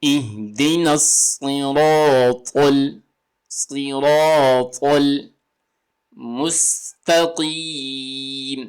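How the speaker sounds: drawn-out measured recitation of the verse; slow Quran recitation. Shown as a demonstration. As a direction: recite each word slowly and clearly. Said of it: "تو کیا پڑھیں گے ہم".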